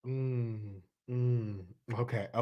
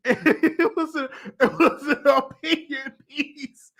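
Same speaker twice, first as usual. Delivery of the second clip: laughing; 6 semitones higher, slower than usual. Real, not acted. Laughing while defending the source.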